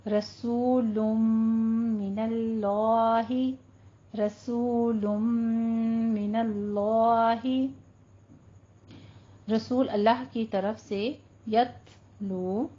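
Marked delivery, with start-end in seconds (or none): 0.1-7.7 "रसूलुम मिनल्लाही" slowly, the m merged in a long hum; chanted "रसूलुम मिनल्लाही" with held nasal; idgham with ghunna. Shown as a demonstration. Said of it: "इदगाम माल गुन्ना होगा". 11.5-12.7 "यतलू" slow with a puff of breath after t; drawn-out "यतलू", whispery t release; hams on ta. Shown as a demonstration. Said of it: "थोड़ा सा हमस करेंगे".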